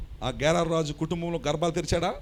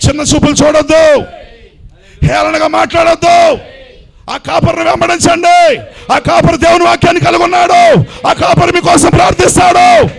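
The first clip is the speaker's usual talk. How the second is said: climactic preaching; 11 semitones higher, 16 dB louder than usual. Real, not acted. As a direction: drag out the last words in a triumphant cry. Preaching at full force.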